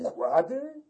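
the judge cries out with unusual zeal; startled cry. A narrator's reading. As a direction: fling "Układy?" back in disbelief. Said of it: "krzyknął sędzia z niezwykłym zapałem".

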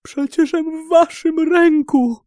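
loud, very high plea; desperate protest. A narrator's reading. Captive in the sultan's hands, desperate protest. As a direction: protest in a high, strained cry.

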